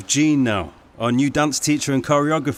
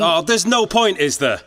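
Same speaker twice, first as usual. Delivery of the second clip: sarcastic retort; 5 semitones higher, 4 dB louder than usual. Real, not acted. Husband retorting in quarrel.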